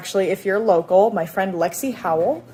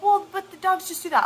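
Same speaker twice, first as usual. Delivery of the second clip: higher, lightly voiced shrugging husband; mock dismissive; 12 semitones higher than usual. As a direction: brush it off like it's nothing. Husband brushing off the bite.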